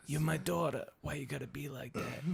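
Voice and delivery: soft, whispery, mumbled mock tough guy; breathy, fading whispery delivery. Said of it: "way more whispery than that".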